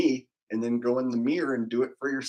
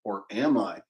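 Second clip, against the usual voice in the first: ordinary talk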